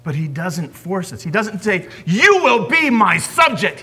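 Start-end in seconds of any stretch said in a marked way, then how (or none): 2.1-3.8 louder, higher command. Pretend. Preacher voicing a God who forces, commanding. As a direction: bark it like a tyrant.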